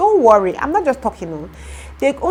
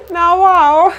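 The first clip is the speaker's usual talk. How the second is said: a shrieking laugh, then a loud high "now, wow"; incredulous laughing shout; 12 semitones higher, 10 dB louder than usual. Retold or imitated. Telling viewers how the workman shocked her, in a loud laughing disbelief. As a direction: laugh out your disbelief at full volume.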